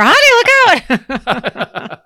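high, loud pretend shriek of warning; hammed-up backseat-driver scream; mock scream. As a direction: yelp it like a panicked passenger, playfully. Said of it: "the navigator and the screamer".

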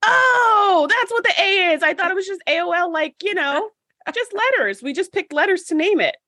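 high, fast dawning surprise; surprised realization. Retold or imitated. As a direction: act out the lightbulb moment, high. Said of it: "I was like".

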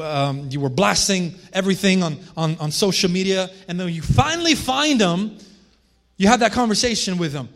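normal talking voice

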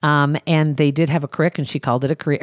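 ordinary talk

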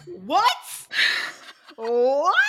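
high, stunned exclamations with long gaps; mind-blown shock. Retold or imitated. Reader reacting to the big reveal, loudly astonished. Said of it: "I remember reading this, being like".

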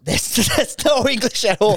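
high, laughing through the words; can't stop cracking up at the label; giggling disbelief. Real, not acted. Both crack up over beer label.

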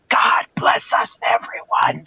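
slow, hoarse stage whisper; Kid Whisper. Retold or imitated. Tiny Tim character finally speaking, slow whisper. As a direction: whisper it slowly as a blessing. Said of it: "this was Kid Whisper, where he just goes".

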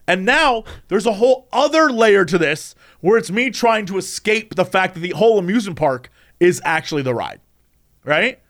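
ordinary delivery

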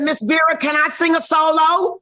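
put-on high, eager little-girl plea; a five-year-old begging to sing. Asking Miss Vera for a solo, high eager child voice.

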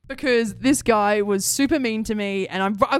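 ordinary talk, nothing marked

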